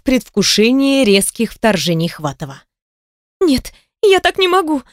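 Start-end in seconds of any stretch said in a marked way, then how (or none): 3.4-4.8 high, moaning cry of refusal; moaning protest. Acted. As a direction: moan it out in protest. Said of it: "тут же застонала".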